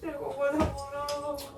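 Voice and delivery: soft, slow tearful plea; drawn-out weeping entreaty; crying. Real, not acted. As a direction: beg slowly and quietly through tears. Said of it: "কান্না করো না".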